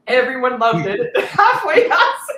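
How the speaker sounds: high, loud laughing voice. Real, not acted. Telling about the car's bullet hole, laughing. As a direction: laugh while quoting the friends.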